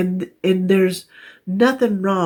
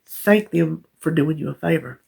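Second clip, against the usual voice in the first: ordinary talk, nothing marked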